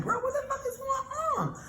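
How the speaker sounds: high squeaky shocked voice; shocked disbelief. Retold or imitated. Retelling finding the mess, squeaky shocked cry. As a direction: shriek it in stunned disbelief. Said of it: "So I'm like".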